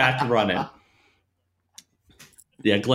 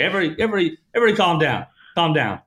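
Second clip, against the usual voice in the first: higher pleading call to calm down; 7 semitones higher, much faster than usual. Retold or imitated. Trainer pleading with the class to calm down. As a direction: settle the room down.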